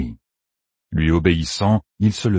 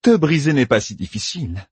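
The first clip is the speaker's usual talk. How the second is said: higher, gloating taunt; triumphant; 8 semitones higher than usual. A narrator's reading. Tormentor taunts the man she is breaking, triumphant. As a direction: savour the victory over your captive. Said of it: "triompha Sémirage".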